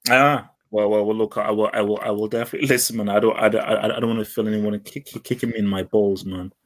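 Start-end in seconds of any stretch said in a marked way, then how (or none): none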